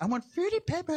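put-on childish pleading that climbs at the end; whining little brother. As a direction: imitate a little brother demanding his cereal. Little brother whining for other cereal.

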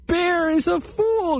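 very high squeaky taunting; mocking chipmunk laugh. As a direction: squeal it out in mockery. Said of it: "Chipmunk began to laugh".

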